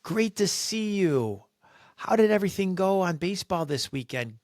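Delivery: high, gushing, sugary voice; bubbly compliments. Retold or imitated. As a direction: gush praise at everyone. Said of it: "bubbly, energetic, charismatic person walks in".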